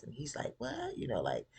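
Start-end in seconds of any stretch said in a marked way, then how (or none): 0.6-1.0 a high, fake what; mock surprise. Gideon playing dumb, high squeaked what. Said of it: "looking all surprised".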